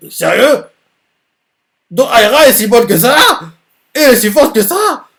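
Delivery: high, loud astonishment; stunned to hear how strong Aira is; shocked disbelief. Acted. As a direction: gasp it out, amazed. Character reacts in shock.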